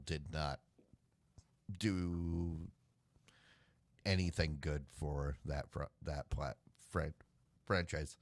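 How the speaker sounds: words broken by hiccups. Real, not acted. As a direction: stumble through with hiccups. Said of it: "I got the hiccups now".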